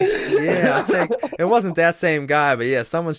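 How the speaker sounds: high, breathy laugh running into the words; laughing while talking. Real, not acted. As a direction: talk through the giggles. Laughing at friend's joke.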